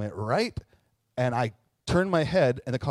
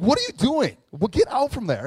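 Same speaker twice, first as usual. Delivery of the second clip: high, louder scolding; imitating a woman; 8 semitones higher, 5 dB louder than usual. A sensible woman scolds the boys, imitating. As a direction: scold him out from under the car. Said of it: "They're like".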